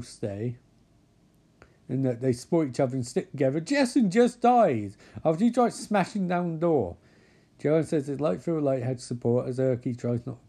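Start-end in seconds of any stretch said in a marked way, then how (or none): 3.6-7.0 high, incredulous outburst; exasperated raised voice. Real, not acted. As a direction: protest loudly at the absurdity. Exasperated interjection at the story.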